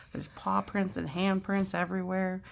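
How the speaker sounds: usual speaking voice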